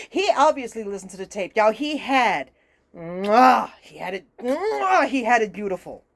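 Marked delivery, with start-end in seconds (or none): 2.9-3.7 smacked "mwah" of approval; chef's kiss. Real, not acted. Fan praising an actor's Cajun accent, chef's kiss. 4.4-5.1 big drawn-out "mwah"; chef's kiss. Real, not acted. Fan praising an actor's accent once more, chef's kiss.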